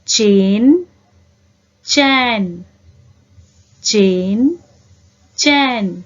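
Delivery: चेन with a clean e, चैन with an open ai, each separated by pauses; e and ai contrast. Shown as a demonstration. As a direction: alternate चेन and चैन slowly for the class. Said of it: "आप भी मेरे साथ पढ़िए".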